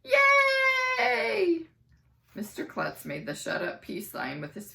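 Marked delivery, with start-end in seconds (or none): 0.0-1.7 very high, loud shouted yay; a crowd of kids cheering. Acted. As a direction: cheer as loud as you can.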